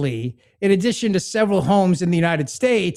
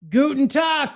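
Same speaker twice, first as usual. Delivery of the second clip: higher, hammed-up German greeting; mock German greeting; 9 semitones higher, slower than usual. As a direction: toss off a jokey German hello. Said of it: "he was probably like".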